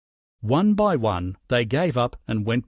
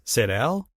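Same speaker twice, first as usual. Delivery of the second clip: ordinary delivery, nothing marked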